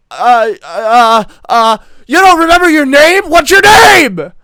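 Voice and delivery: high, very loud, stretched-out yell; stammer, then bellow the question; screamed demand. Acted. Tough woman shaking a stammering kid, screaming at him.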